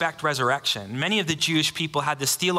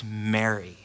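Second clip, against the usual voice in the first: Jesus calling her name; 5 semitones lower than usual. Acted. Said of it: "He says".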